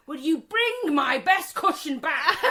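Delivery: slow, high, loud mock mum calling out through a laugh; nagging mum. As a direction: play the mum calling for her cushion, laughing. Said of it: "her mum used to come out and go".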